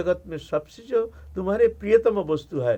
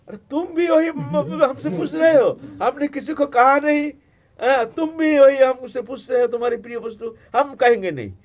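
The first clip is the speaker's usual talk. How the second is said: flustered Shiva; 7 semitones higher, 6 dB louder than usual. Acted. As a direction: panic at being asked again. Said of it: "आप घबरा गए". (घबराए हुए शिव, ऊँची आवाज़ में)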